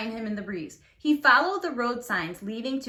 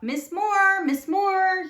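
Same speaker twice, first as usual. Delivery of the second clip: high, loud call into the house; 9 semitones higher, 5 dB louder, much slower than usual. A narrator's reading. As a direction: call her name from the doorway. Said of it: "he called".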